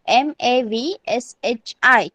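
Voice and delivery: एम ए वी एस एच आई, one letter at a time; spelling out. Shown as a demonstration. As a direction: slowly spell एम ए वी एस एच आई. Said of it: "कैसे pronounce करेंगे".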